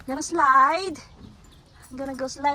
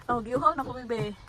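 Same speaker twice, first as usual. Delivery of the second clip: ordinary talk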